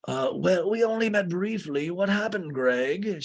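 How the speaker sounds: high-pitched put-on voice; Lisa. Lisa asks Greg about his injured hand, high imitation.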